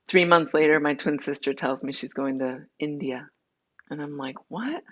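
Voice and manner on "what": sharp, high disbelieving what; a startled, scoffing what; incredulous what. Retold or imitated. Reacting to her sister's healing-session plans with high disbelief. Sister announces trip to India.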